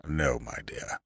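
low, hushed reproof; quiet, disapproving elderly lawyer; reproving. A narrator's reading. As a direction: gently put her in her place. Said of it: "said Mister Murbles reprovingly".

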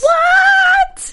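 very high, loud "what" of disbelief; shocked shriek. Real, not acted. Co-host hears her friend met the star, shocked shriek. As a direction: shriek it in astonishment. Reacting to news of meeting him.

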